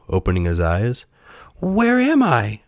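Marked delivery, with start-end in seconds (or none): 1.6-2.6 slow, high, dazed voice; dazed puppet. A narrator's reading. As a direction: ask slowly as if just waking. Puppet wakes confused.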